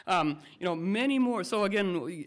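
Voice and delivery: ordinary talk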